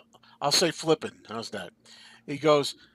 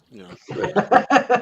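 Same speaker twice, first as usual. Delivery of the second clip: high, laughing aside; 8 semitones higher, 8 dB louder than usual. Real, not acted. Storyteller amused by the customer's added oath, laughing. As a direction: crack up while talking. Cracking up at own quote.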